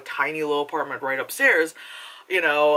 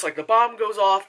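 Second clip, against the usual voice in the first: ordinary talk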